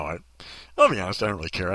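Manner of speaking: usual speaking voice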